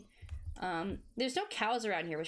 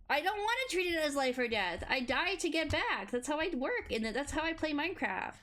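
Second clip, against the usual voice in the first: emphatic; 6 semitones higher than usual. Real, not acted. Defending playstyle.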